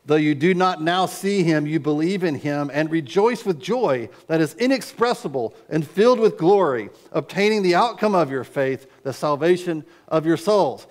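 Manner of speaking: preacher reading scripture with fervor; reading scripture aloud. Acted. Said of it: "He says".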